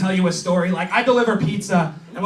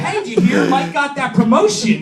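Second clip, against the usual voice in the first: higher-pitched mock gossip; eager office gossip; 6 semitones higher, 4 dB louder than usual. Coworker sharing promotion news, mock-eager gossip.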